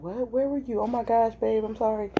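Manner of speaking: light, high mock apology; mock innocent apology. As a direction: play-act a sweet, surprised excuse. Acts out boyfriend's innocent apology.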